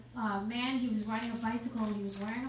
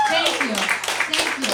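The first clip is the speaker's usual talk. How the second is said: hype; 2 semitones higher, 14 dB louder, much slower than usual. Real, not acted. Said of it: "Give it up for my daughter".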